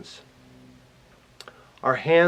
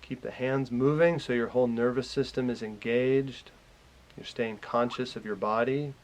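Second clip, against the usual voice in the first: calm meditation guide, growing softer and lower; guided meditation; 3 semitones lower, 7 dB quieter than usual. Real, not acted. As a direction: lead them gently through relaxing, with long pauses. Leads a guided relaxation.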